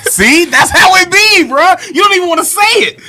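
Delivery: very high, loud, laughing tease; real laughing shout at a friend; teasing laughter. Real, not acted. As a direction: crack up while teasing him. Laughing teasing friend about crying.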